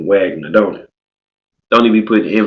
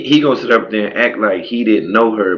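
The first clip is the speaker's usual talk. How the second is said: ordinary delivery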